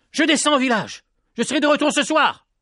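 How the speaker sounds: put-on high boyish voice set firmly; the boy standing his ground. A narrator's reading. Petit Jean looks the lady in the eye and firmly insists, high boyish voice. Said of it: "Avec fermeté".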